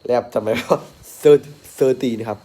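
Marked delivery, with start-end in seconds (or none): 1.2-2.1 thirty thirty drawn out very slowly, tongue out for th; patient slow teaching of thirty; slow demo. Shown as a demonstration. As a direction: stretch each thirty slowly for the child. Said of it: "แลบลิ้นออกมา".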